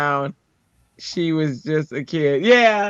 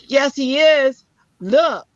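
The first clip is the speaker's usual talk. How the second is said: put-on higher, louder kid insisting; imitated loud child; 9 semitones higher, 4 dB louder, slower than usual. Pebbles insists to mom in the store, loud child. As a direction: argue back like a stubborn child. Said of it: "she's so loud".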